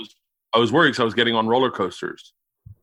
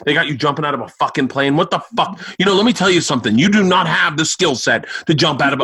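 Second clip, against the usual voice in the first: imitated rant building high and loud; outraged dad; 5 semitones higher, 4 dB louder than usual. Dad to son jumping out of a plane, outraged. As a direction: scold like a father losing his temper, pitch climbing.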